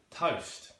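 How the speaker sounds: "Toast" with a wide vowel; East London pronunciation. Shown as a demonstration. Teacher demonstrating East London, "Toast" with wide vowel. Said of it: "Another wide vowel".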